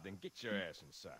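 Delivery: dropped voice. Acted. Aside order, voice drops.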